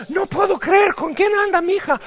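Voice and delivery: high-pitched scandalised mother; a mother gasping over her daughter's boyfriend; mock shocked mother.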